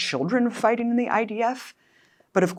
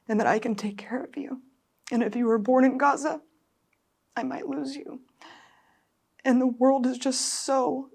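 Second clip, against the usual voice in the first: high, breaking voice, close to crying; tearful mother, voice breaking; near tears; 5 semitones higher than usual. Real, not acted. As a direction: speak through tears. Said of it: "I'm so emotional about it".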